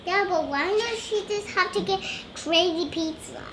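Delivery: ordinary talk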